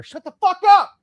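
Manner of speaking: sudden furious shout off to the side; real angry outburst; sudden yell. Real, not acted. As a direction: yell it out at full force. Yells at someone off mic.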